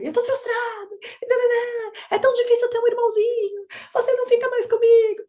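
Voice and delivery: put-on high, whiny upset toddler; frustrated child. Mother voices the frustrated toddler missing her, high whine. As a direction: sulk it like a jealous kid with a new baby brother.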